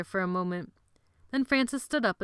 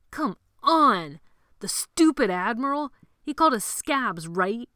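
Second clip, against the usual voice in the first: loud, high, scornful of the admiral; rousing; 5 semitones higher, 7 dB louder than usual. Acted. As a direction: whip up the gang against the admiral. Sebastian rouses the boys.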